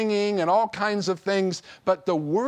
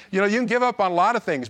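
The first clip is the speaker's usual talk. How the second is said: rushed repeat; much faster than usual. Shown as a demonstration. Preacher to congregation, rushing the repeat before the turn. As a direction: race through it to get to the but.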